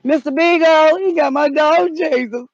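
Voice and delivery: high, hammed-up wail for the lost dog; calling out in distress. Dog owner crying out for missing pet, distressed high call. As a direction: call out high, as if pleading for help.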